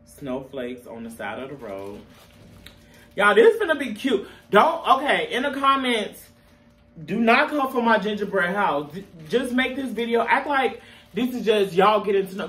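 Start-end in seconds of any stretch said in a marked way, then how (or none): 3.2-11.3 high and louder, upbeat to viewers; excited. Real, not acted. Vlogger shows off her gingerbread house, bright and excited.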